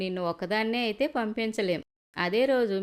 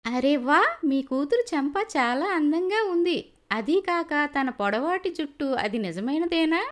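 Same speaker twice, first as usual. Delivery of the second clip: high, bright-eyed praise for the bride; delighted admiration; 6 semitones higher than usual. Acted. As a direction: gush over her hair, much higher. Groom's mother admiring bride.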